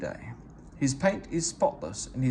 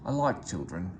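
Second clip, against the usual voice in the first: simply; 6 semitones lower than usual. A narrator's reading. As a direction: say it plainly and gently, no fuss. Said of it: "he said simply".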